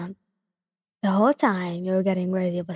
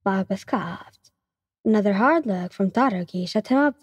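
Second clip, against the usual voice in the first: plain reading voice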